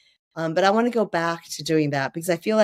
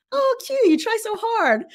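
high, cooing mock praise; patronizing praise. Author mimics people praising her Chinese, high cooing tone. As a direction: pat a foreigner on the head with your voice, up high. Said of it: "I've gotten the".

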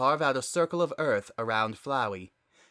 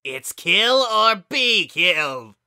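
high, loud, slow monstrous shriek; shrieking flower; 8 semitones higher, 11 dB louder, slower than usual. Acted. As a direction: shriek it like a deranged little monster. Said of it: "Flowey shrieked".

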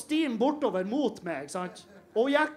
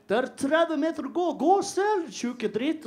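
a touch of an irritated driver, a bit higher; irritated taxi driver; 6 semitones higher, slower than usual. Retold or imitated. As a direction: snap it at him like an annoyed cabbie. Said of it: "var irritert for da". (litt irritert taxisjåfør)

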